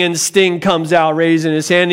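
ordinary talk

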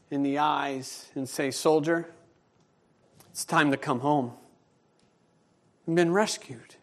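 quiet, heartfelt pastoral voice; tender preaching. Acted. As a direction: bring it down gently to comfort the church. Soft tender sermon close.

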